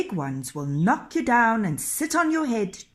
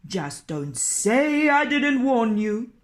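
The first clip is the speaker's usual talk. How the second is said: slow, raised, foreboding warning; ominous warning; 5 semitones higher, 6 dB louder, slower than usual. Acted. Innkeeper warns Nils while leaving, slow and ominous. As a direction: leave him with a dark threat.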